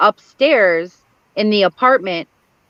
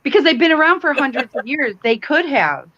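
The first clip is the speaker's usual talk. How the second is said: squealing much higher with delight; excited; 6 semitones higher than usual. Real, not acted. Host thrilled by Debbie's theory, much higher squeal. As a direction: gush over the theory.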